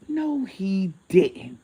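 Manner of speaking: slow, drawn-out scandalised disbelief; mock disbelief. Teller realising what the man did, slow disbelief. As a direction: stretch each word in theatrical shock. Retelling her shocked realisation.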